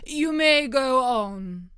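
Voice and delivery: slow, loud royal dismissal; a grand king waving him off; king's voice. A narrator's reading. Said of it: "said the king".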